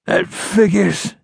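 slow, high-pitched sneer; contemptuous sneer. A narrator's reading. Dying outlaw learning who shot him, sneering. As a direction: drag it out with contempt. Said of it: "gave a sneer of contempt".

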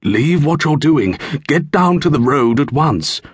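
mysterious stranger urging Bjarni to flee; urgent stranger. Acted. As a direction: hurry the boy away. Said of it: "the stranger cried".

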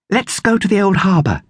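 a second voice from the boat; another child. A narrator's reading. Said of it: "came another voice".

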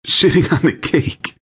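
high, squeezed, out of breath from laughing; gasping with laughter. A narrator's reading. Narrator doubled over laughing at the cake, high gasp. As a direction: squeak it out while cracking up.

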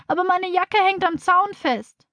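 put-on slow, high plaintive child; whining. A narrator's reading. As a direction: draw out the complaint, pitched high. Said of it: "jammert Tina". (langsames, hohes Jammern)

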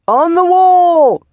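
high, loud, drawn-out shout; calling up from below. A narrator's reading. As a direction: holler it up to the top of a wall. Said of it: "came a call from below".

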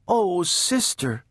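high, slow, pleading little-sister voice. A narrator's reading. Baby begs her sister not to yell, pleading. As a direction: plead gently, drawn out and high.

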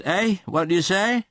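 startled cry. A narrator's reading. Sir Leicester starting up from a doze, mildly startled cry. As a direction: wake with a start and ask. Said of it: "and cries".

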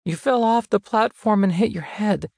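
put-on frightened little boy, voice wobbling; shaky voice. A narrator's reading. Small boy tells his injured brother, mildly shaky. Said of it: "voice shaky".

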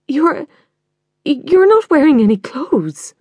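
high, halting, slow disbelief with a stumble on you're; shocked stammer. Acted. Daughter appalled at her naked mother, high stammering disbelief. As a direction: stammer it out, appalled, leaning on not.

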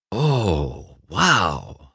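drawn-out awed reaction; impressed. Acted. Lightning seeing the massive lobby, impressed. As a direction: breathe in the sight with wonder. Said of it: "said Lightning, impressed".

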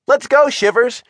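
high-pitched hurry-up; urgent call. A narrator's reading. Margot calls Shivers over the railing, urgently. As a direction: call to hurry a lagging friend. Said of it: "she called".

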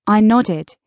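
usual speaking voice

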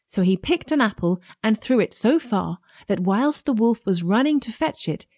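usual speaking voice

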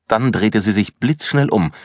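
ordinary delivery, nothing marked

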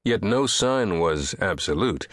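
plain reading voice

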